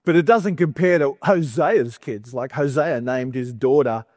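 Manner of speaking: usual speaking voice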